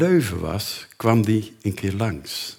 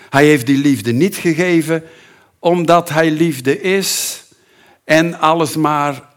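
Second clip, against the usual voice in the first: loud, high, slow proclamation; preaching at full force; 7 semitones higher, 7 dB louder than usual. Acted. Preacher declares God is love, loud and slow.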